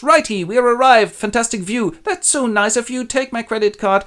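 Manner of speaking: high, chirpy tour-guide voice; cheery Edgar. Acted. Edgar fakes arrival at the cliff for his blind father, chirpy. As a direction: play the breezy guide.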